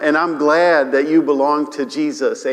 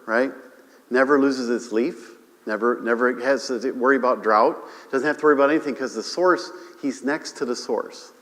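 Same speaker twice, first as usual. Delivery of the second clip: ordinary delivery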